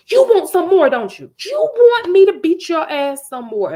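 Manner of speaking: high, loud menacing threat; threatening mom. Retold or imitated.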